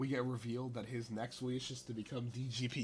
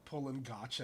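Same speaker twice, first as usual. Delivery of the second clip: ordinary delivery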